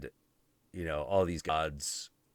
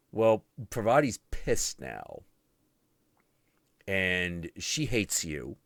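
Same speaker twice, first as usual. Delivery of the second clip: normal talking voice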